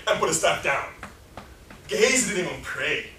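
ordinary talk, nothing marked

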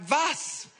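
high, loud, amazed outburst; amazed exclamation. Retold or imitated. Pastor tells the teenager what he just prayed, high and amazed. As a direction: burst out in disbelief.

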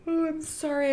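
talking through a yawn; stretched yawn on the words. Real, not acted. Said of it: "I'm yawning".

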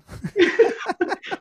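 squealing laugh; high burst of laughter. Real, not acted. Laughing at turkey joke.

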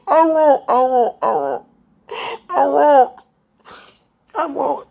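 crying out high and loud; sobbing the promise through tears; tearful promise. Real, not acted. Promising Jesus through real tears, loud and high. Promising tearfully after being told not to cry.

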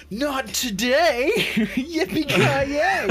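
high, slow hammed-up hero yell with a laugh; mock action hero cry. Player stopping a robbery, mock heroic cry. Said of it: "and I was like".

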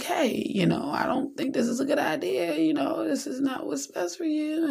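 a hint of a soft-spoken God; gentle advice. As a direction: coax like a patient parent.

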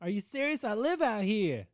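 put-on high-pitched East Coast accent; exasperated East Coast local. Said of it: "That's my East Coast accent".

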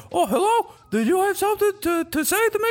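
very high, put-on voice; hesitant high-pitched imitation of a puzzled caller; person on the line. Other person still on the phone, high and hesitant. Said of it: "the other person's like".

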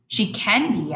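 ordinary delivery

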